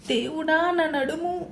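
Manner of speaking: high, strained groan of pain; pained moan. A narrator's reading. Cousin moans about her hurt back, pained.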